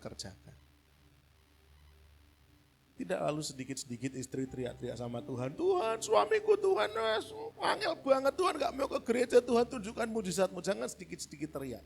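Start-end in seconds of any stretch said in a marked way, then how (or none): 5.5-10.6 high, loud complaining wail to God; mock wailing wife. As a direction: ham up a desperate wife. (istri mengeluh kepada Tuhan, merengek tinggi)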